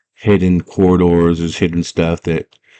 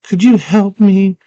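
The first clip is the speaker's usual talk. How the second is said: put-on high, drawn-out girl's request; pleading girl voice; 12 semitones higher, slower than usual. Girl asking Hoggle for help, high pleading voice. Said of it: "She's like".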